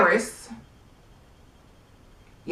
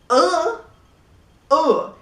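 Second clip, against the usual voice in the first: disgusted ugh; 8 semitones higher than usual. Real, not acted. Disgust at the outfit.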